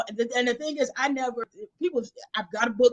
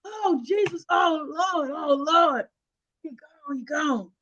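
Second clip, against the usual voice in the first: grandmother lamenting; 6 semitones higher, slower than usual. Retold or imitated. Grandmother mourning on the phone, mock lament. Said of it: "but do the voices".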